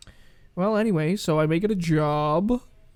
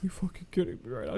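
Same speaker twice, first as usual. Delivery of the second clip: hushed disbelief; 7 dB quieter, faster than usual. Retold or imitated. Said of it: "whispering back and forth so we couldn't".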